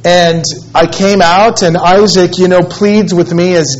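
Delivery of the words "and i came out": usual speaking voice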